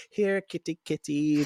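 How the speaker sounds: mocking here-kitty call; calling a cat. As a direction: lure her out like a pet. Vampire calling the Slayer like a cat.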